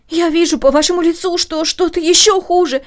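high, loud dread; fearful plea. Acted. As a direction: fear pushing the words out. Daughter dreading father's death.

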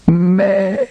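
very high, wailing sheep's baa; plaintive bleat. Acted. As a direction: bleat like a lost lamb in distress. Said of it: "ein jammervolles".

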